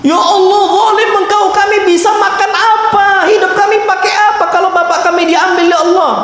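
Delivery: put-on high, wailing cry of grieving children; mock wailing lament. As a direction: wail to God like orphaned kids. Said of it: "Dengar antum ucapan itu?". (ratapan anak yatim yang dibuat-buat)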